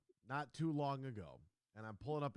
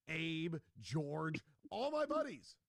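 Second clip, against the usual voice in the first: gushing; 3 semitones higher, 3 dB louder, much slower than usual. Pretend. Affectionate about his money.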